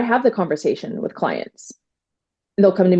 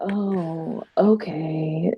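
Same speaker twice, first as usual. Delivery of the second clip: softer, mock-sad little oh okay; faked sadness; 5 dB quieter, much slower than usual. She tells James how she faked disappointment, in a softer mock-sad voice. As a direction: pretend to be let down while secretly glad. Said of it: "trying everything to look sad".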